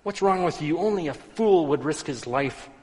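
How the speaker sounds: high, loud furious yelling; angry shout. Acted. Passerby shouting at the old man, furious high yell. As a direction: berate him at the top of your voice. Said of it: "shouts at him".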